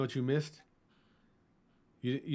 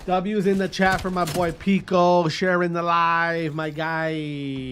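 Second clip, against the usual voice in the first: hype shout-out; 3 semitones higher, 8 dB louder, slower than usual. Real, not acted. Hyping a viewer on stream.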